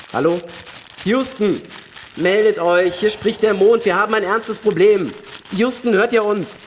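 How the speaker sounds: desperate shouting into the radio. Acted. Desperate radio call to Houston.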